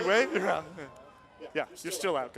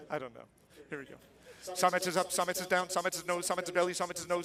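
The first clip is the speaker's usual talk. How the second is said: usual speaking voice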